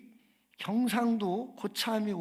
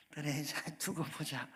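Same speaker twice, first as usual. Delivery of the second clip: low, hushed mock menace; quietly plotting mock revenge; muttered payback threat; 7 semitones lower, 11 dB quieter than usual. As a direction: mutter it under the breath, much softer and lower. Joke: new senior plots payback.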